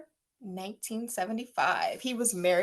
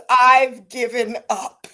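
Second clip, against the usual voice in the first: mock defeated; 8 dB louder, much slower than usual. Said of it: "The sweatsuit that just says".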